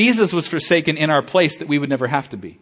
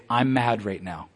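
quiet admission; 6 semitones lower, 6 dB quieter, slower than usual. Acted. Voicing an honest prayer to God.

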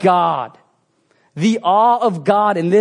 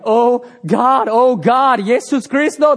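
crying out; 5 semitones higher, 5 dB louder, slower than usual. Retold or imitated. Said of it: "They're crying".

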